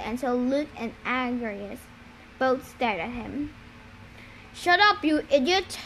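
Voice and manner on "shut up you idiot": sharp, raised-pitch scolding; growled order. A narrator's reading. Agrias silencing his laughing brother, growled order. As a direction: scold your brother, starting high. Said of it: "Agrias growled".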